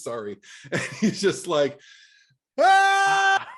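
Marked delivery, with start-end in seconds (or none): 2.6-3.5 high, loud piercing scream; full-voice scream. Retold or imitated. Friend imitating host's scream at the end of Carrie, full-voice scream. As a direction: let out a full terrified scream. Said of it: "Full voice".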